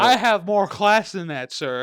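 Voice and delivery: mock snooty. Mock offended snob.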